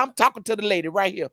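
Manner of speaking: normal talking voice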